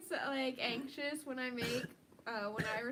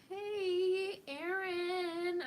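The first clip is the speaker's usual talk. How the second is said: excited greeting; 6 semitones higher, 4 dB louder, much slower than usual. Real, not acted. Greeting viewer by name.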